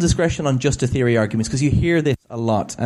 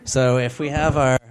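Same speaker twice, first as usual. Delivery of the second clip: usual speaking voice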